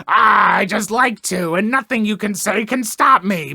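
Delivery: cartoon villain. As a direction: ham it up like a Captain Planet villain. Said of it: "a Captain Planet villain".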